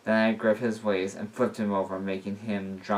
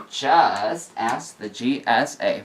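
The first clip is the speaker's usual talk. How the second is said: slow emphatic; 4 dB louder, much slower than usual. Real, not acted.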